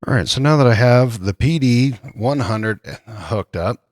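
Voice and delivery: usual speaking voice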